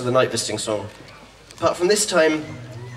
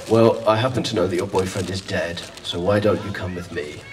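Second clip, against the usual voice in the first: lower, lightly voiced shady stranger; a hint of a sly chancer making his pitch; mildly sinister low suitor voice; dodgy chap; 8 semitones lower, 4 dB quieter than usual. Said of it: "a fairly dodgy looking chap".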